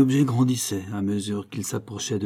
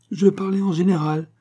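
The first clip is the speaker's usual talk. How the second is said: cutting in; 8 semitones higher, 3 dB louder than usual. A narrator's reading. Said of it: "coupa l'ange".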